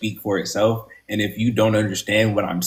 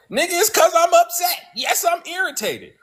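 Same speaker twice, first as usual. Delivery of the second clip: high-pitched, exasperated yelling; frustrated yell. Acted. Host acts out snapping back at online audience, frustrated yell. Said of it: "if I get frustrated and I yell".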